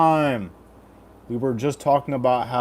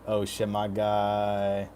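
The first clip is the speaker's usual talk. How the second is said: ordinary talk, nothing marked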